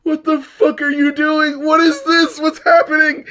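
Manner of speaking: high, laughing disbelief; what is this, half laughing; baffled disbelief. Retold or imitated. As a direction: squeal it out in baffled horror. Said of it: "I'm just like".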